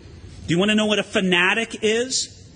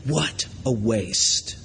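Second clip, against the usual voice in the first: low, slow, much softer scorn; disdainful disciples; 11 semitones lower, 4 dB quieter, much slower than usual. Retold or imitated. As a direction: sneer it slowly, down low. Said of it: "and they said".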